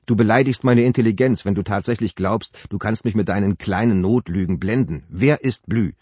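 lauter, schneidend empört. Acted. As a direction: lass sie hart und beleidigt klingen. Sabine durchschaut Jupps Notlügen. (Sabine weist Jupps Notlüge laut und empört zurück)

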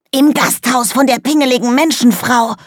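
hoch, schrill und aufgeregt; Koboldstimme. Acted. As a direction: quirliger kleiner Kobold, ganz aufgedreht. Kobold Fitz spricht. (aufgeregte, hohe Koboldstimme)

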